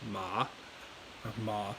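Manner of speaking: "ma" dropped low with a slight dip; third tone, low. Shown as a demonstration. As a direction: sink the pitch on "ma". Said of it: "and then third tone".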